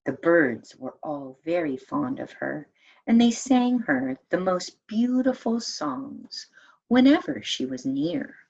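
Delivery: plain reading voice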